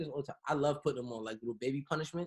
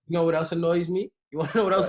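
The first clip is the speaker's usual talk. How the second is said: loud emphatic question; 9 dB louder, faster than usual. Real, not acted. Leading into a complaint, emphatic.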